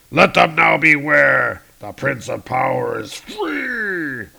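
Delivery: loud proclamation, slowing at end; triumphant Hercules. Acted. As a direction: declare freedom proudly.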